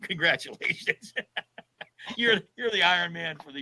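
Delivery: congratulations breaking into a laugh. Real, not acted. Ending the prank on the winner, laughing congratulations. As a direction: let the laugh spill through the word.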